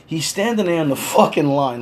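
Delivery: ordinary talk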